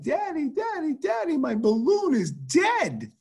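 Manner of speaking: put-on high, slow wail of a sad little girl; imitating a crying daughter; crying child. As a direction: whimper it like a heartbroken kid. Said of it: "she'd be crying like".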